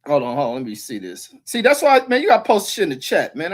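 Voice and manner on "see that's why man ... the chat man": loud, high complaint at the chat; exasperated shout at the stream chat; frustrated call-out. Real, not acted. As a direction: raise your voice and scold the viewers. Scolding chat for not posting.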